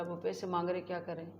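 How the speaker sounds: scared brother quietly asking his little sister; retold hushed question. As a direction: lean in and ask quietly, scared. Scared kids on bus, no fare.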